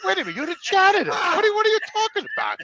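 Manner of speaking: high-pitched, rushed retold disbelief; incredulous challenge. Patient questioning a janitor about his news, incredulous and high. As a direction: act out baffled alarm, voice shooting up.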